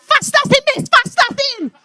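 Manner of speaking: high, loud schoolboy chant; shouted heckle. Acted. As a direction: yell it like an overexcited pupil. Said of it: "and then you get".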